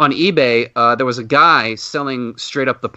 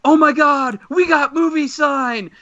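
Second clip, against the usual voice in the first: excited shout; 13 semitones higher, slower than usual. Retold or imitated. Fan shouting at the show host, high excited yell. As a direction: shout it out like the big moment has come. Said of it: "And then I shouted".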